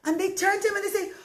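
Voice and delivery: ordinary delivery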